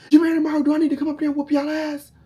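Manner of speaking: high, loud furious mother; angry mom yelling. Retold or imitated. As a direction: yell like a mad mom. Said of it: "my mom on the phone like".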